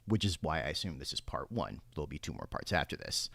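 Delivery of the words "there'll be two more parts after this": ordinary talk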